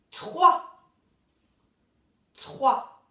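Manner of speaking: "trois" loud, the tr blend clearly sounded; dictated syllable. Shown as a demonstration. Teacher dictates the third syllable to pupils, loud and deliberate. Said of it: "pour la troisième syllabe".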